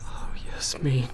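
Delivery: sorrowful breathy whisper. Acted. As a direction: sigh her name with pity. Pitying whisper.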